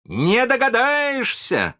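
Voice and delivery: high, slow, triumphant cry; proud shout. A narrator's reading. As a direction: crow it out proudly. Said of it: "кричал с гордостью Питер". (гордый высокий крик)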